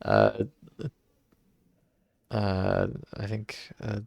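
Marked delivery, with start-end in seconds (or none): none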